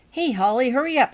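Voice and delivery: loud, high call to hurry; shouted call. A narrator's reading. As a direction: call out to her across the room. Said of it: "shouted Mark".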